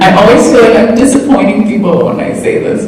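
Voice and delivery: ordinary delivery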